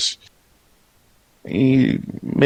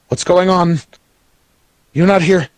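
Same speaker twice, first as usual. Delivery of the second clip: high, louder panicky goblin; acted nervous disbelief; worried; 10 semitones higher, 4 dB louder than usual. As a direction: look around in alarm, voice pitched up. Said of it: "he looks around all worried".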